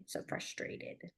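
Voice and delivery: unvoiced slow whisper; whispered demo. Shown as a demonstration. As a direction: breathe it quietly. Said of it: "getting annoyed".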